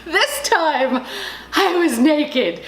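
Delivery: real laughter breaking through the words; slow the punchline down through a laugh. Real, not acted. Storyteller confessing she was locked out naked, laughing.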